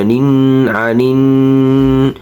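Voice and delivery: নিন with a long hummed ন; obligatory ghunnah. Shown as a demonstration. Teacher shows ghunnah, long nasal ন in আনিন. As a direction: hold the nasal n in আনিন, slowly. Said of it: "ওয়াজিব গুন্না".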